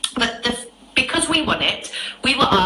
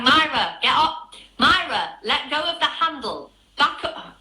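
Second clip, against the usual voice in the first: higher-pitched mock shout through a door; imitated shouting; 6 semitones higher than usual. Gus calls to the woman behind the rattling door, imitated shouting. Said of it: "Gus is shouting".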